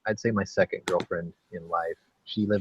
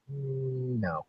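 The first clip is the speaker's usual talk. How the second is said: drawn-out, softer hum sliding down into a no; drawn-out reluctant no; 6 semitones higher, 7 dB quieter than usual. Retold or imitated. Retelling own thought about the Saturday gig, drawn-out reluctant no. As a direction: mull it over, then turn it down.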